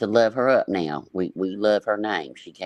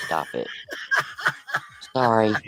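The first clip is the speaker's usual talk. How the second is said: laughing. Real, not acted. Laughing at own joke.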